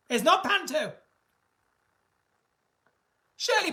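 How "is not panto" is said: normal talking voice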